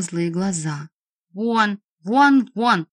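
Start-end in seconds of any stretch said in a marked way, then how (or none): 1.3-2.9 mildly raised, insistent repeats; stamping tantrum. A narrator's reading. Boy driving the vampire away, angry tantrum. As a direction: punch each word like a stamped foot. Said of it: "затопал ногами Велигор".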